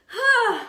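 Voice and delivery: loud, high exhausted sigh; strained sigh. Real, not acted. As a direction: heave out a loud sigh of effort. Tired, holding the plank.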